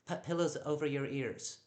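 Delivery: ordinary delivery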